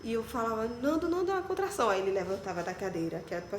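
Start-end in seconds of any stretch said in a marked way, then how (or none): 0.8-1.9 urgent call. Retold or imitated. Calling husband during contraction.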